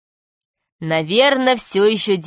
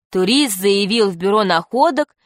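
usual speaking voice

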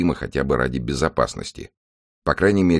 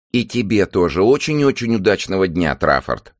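high, louder hostess voice; lightly voiced cheery TV host; 6 semitones higher, 4 dB louder than usual. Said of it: "раздался голос куколки".

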